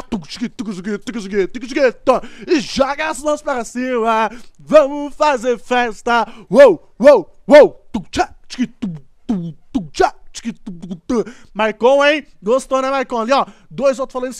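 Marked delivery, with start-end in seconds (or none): 0.0-2.3 mock beatbox. Imitating the bass beat. 2.5-7.8 rally the crowd like an MC; party hype shout. Acted. Gamer hyping viewers to the car stereo beat, loud MC whoops. 7.9-11.3 mock beatbox. Imitating the bass beat.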